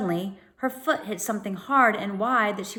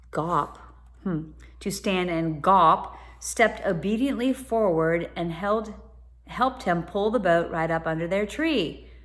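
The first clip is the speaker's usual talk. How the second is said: ordinary delivery, nothing marked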